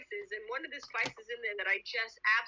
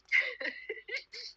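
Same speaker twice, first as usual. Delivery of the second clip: laugh; 11 semitones higher than usual. Real, not acted. Laughing after quoting friend.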